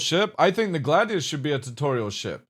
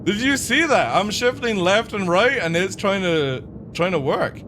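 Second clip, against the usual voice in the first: excited; 6 semitones higher than usual. Real, not acted. Amazed at AI in fight.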